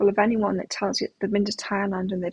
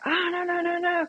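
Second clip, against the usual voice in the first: mock protest; 9 semitones higher than usual. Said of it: "I'm like".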